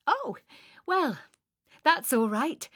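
high, light cheerful reassurance. A narrator's reading. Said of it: "I said cheerfully".

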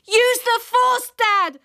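high, slow excited yell; child yelling advice to dad. Acted. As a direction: urge him on at full voice. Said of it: "I yelled".

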